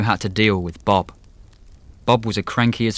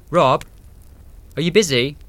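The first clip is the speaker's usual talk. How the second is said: shouting; 7 semitones higher than usual. Acted. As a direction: call out to him from the kitchen. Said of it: "when Dave shouted from the kitchen".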